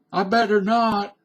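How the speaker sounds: high-pitched hesitant refusal; hammed-up reluctant goody-goody; goody-goody in a skit. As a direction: play a timid rule follower, pitched high. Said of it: "let's put on a little skit".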